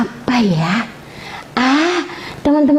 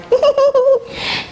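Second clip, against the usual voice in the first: a bright, high burst of laughter; delighted high-pitched laugh. Real, not acted. Mother laughs at child.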